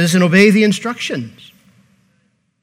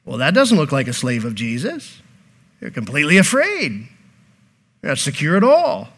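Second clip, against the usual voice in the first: imitating doubtful onlookers, softer with swooping pitch; onlookers' judgement; 4 semitones lower, 4 dB quieter than usual. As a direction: lightly voice a bystander passing judgement. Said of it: "when people see them, they're like".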